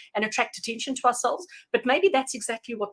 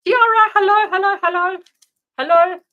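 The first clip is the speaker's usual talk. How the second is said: much higher and louder sing-song hello; excited greeting; 9 semitones higher, 10 dB louder than usual. Real, not acted. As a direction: call out her name like a delighted host. Greeting a viewer arriving on stream.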